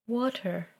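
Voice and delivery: soft and drawn out, savouring it; faint, slow single word; slow, tasting the word. A narrator's reading. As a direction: say it slowly, as if tasting it. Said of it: "She pronounced the word slowly".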